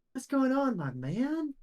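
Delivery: high-pitched, drawn-out greeting in character; Bill. Acted. Game master's Bill greets the group, high-pitched character voice. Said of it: "You see Bill goes".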